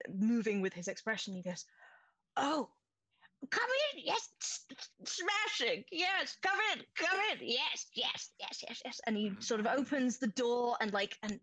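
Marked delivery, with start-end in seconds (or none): 2.4-9.0 much higher, fussing welcome; high, excited Mercane ushering guests in; eager inventor. Acted. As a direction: hurry them in, delighted and jittery. GM voices eager NPC.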